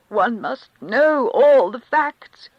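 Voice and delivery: high, slow, thin-voiced insistence. Karenin to the Countess, in a high thin voice. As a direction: stretch it out thin and strained. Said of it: "he said in his thin voice".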